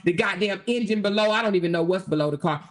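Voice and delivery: ordinary talk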